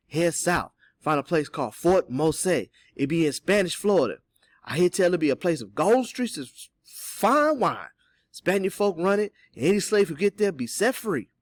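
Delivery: animated character telling what he heard; knowing Cato. Acted. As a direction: give directions in a hearty dialect voice. Voices Cato giving directions.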